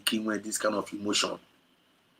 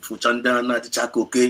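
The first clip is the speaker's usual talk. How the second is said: usual speaking voice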